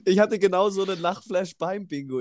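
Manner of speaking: high laugh spilling into words; laughing fit. Real, not acted. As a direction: talk through a giggle fit. Laughing fit, recalls funny story. (lachend erzählt)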